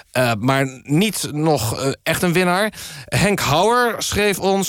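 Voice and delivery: ordinary talk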